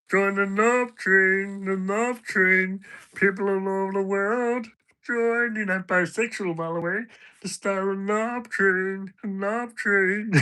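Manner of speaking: squeaky teasing impression of a friend; Patter's voice. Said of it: "Knob train in Patter's voice".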